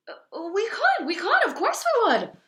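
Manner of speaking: high, loud, eager shouted answer; eager shouting. A narrator's reading. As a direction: shout yes together, thrilled. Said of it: "we all shouted".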